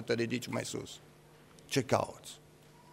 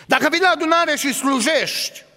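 loud, much higher call; fiery preaching; 6 dB louder than usual. Acted. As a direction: call out the churchgoers. Preacher rising to a climax.

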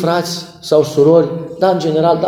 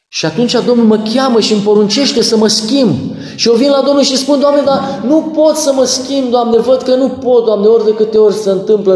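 impassioned preaching; 5 semitones higher than usual. Acted. Pastor to the congregation, impassioned preaching. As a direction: preach it with rising urgency.

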